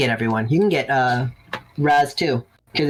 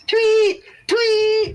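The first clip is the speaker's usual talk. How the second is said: high squeaky tweet of a whistle; whistle imitation. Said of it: "I'm gonna blow the whistles".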